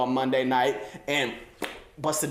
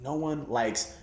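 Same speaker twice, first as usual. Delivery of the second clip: ordinary talk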